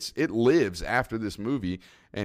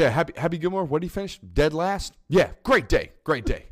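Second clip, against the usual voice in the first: higher-pitched mock-testy press answer; losing composure; 6 semitones higher than usual. Shooter talking to the press about his rival, mock testy. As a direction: imitate a golfer needled about his rival. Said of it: "he, he loses that with the press".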